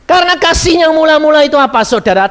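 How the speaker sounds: loud, high preaching call. Acted. Preacher booming a question to the congregation. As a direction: preach it out to the hall.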